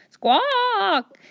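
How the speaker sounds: high, squawking parrot cry; shrill parrot screech. Acted. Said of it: "Igor squawked".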